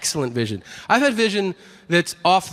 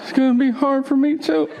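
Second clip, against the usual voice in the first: high, overacted mock sobbing voice; badly faked sadness; 10 semitones higher, 3 dB louder than usual. Husband leaving his wife, echoing her with hammed-up fake sorrow. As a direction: ham up the heartbreak as an acting exercise. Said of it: "like, an acting exercise".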